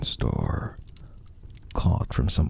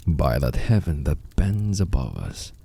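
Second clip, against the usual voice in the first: ordinary delivery, nothing marked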